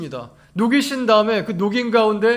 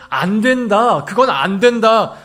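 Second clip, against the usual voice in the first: raised, emphatic refusal; firm loud no; 8 dB louder, slower than usual. Retold or imitated. Preacher voicing God forbidding his children, firm and loud. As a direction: lay down the law firmly.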